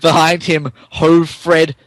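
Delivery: ordinary talk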